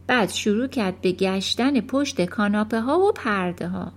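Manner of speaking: ordinary delivery, nothing marked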